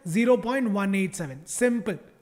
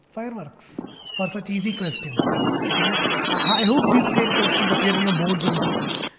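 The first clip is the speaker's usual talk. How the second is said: sarcastic high squeal, very loud and high; hammed-up sarcastic celebration; mock fireworks; 10 dB louder, slower than usual. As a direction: squeal it like fake fireworks going off. Sarcastic mock celebration of easy questions.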